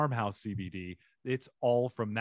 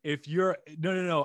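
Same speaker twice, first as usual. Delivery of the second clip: ordinary delivery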